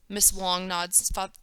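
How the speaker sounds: ordinary delivery, nothing marked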